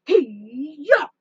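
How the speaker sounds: short fighting yell; battle cry. Acted. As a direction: shout it as the fist lands. Voicing hero's punch attack.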